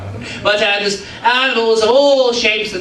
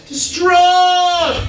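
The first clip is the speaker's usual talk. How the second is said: overacted wail, pitched high; hammed-up despair; 9 semitones higher than usual. Storyteller overacting the man's grief for Bob. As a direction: ham it up, mock anguish. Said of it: "That's acting".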